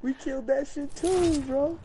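high hyped boast; excited. Real, not acted. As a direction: crow over the kill.

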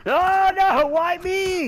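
high, loud "woe is me" cry; hammed-up lament at full voice; dramatic wail. Laments being attacked.

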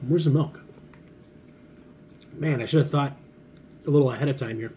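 talking while chewing snack; muffled words around food; mouth full, chewing. Real, not acted. Seen 3: Closed lips, cheeks working; snack bag held; chewing between phrases.

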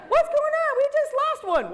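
hammed-up panic; cartoon gasp of alarm; high mock alarm. Alarmed group member.

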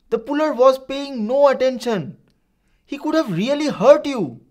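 put-on high, loud, accusing shout at the boy; a red-faced man yelling his point. A narrator's reading. A shouting man demands of Farid, loud and high. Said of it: "The shouting man".

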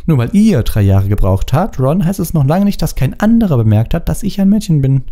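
put-on high, heated girl voice; indignant, high-pitched rebuke. A narrator's reading. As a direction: tell him off, pitched well up. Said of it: "ihre Augen blitzten gefährlich".

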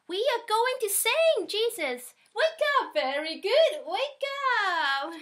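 high loud cries of alarm; shouting. A narrator's reading. Friends calling to Jesus in the storm, high loud shout. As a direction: yell at him, afraid of sinking. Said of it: "shouted Jesus' friends".

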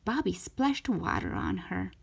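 plain reading voice

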